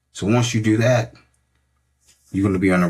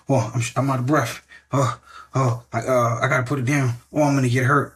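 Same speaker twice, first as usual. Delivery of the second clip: higher, breathless mock panic; mock panicky thoughts; 5 semitones higher than usual. Coach voicing a panicking brain mid-workout, mock panic. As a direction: play the scared brain gasping to quit.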